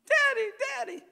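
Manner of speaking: put-on very high, loud childlike calls; excited little girl. Daughter calls out to her dad while being measured, high excited squeal. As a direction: squeal for daddy like a thrilled child.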